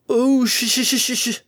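high panicked stuttering cry; panicked stammer. Acted. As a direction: stutter in terror while flung away. Male pony flung away screaming.

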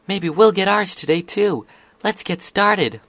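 high, loud, eager exclamations; cheering. Acted. Scootaloo urging friends to start today, cheering. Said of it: "Scootaloo cheered".

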